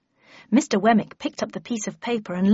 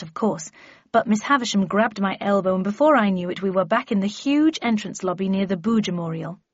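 plain reading voice

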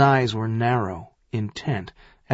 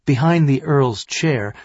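ordinary delivery, nothing marked

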